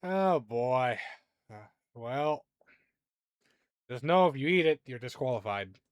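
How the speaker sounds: sigh, then lay down the rule; sighing resignation. Acted. Beam master warning a would-be rope eater, sighing. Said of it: "he signs".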